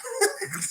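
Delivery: high, short squeal of a laugh; a quick laugh breaking out. Real, not acted. Streamer cracking up at the tweets, high squeal.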